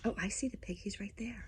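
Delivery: hushed whisper, barely voiced. A narrator's reading. Wolf whispers to the listeners about the pig. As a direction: tell it under your breath.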